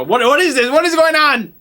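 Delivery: high, loud alarmed yelling; shouting owner. Acted. Shop owner yelling at gunmen, high loud outrage. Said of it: "shouting at him in Arabic".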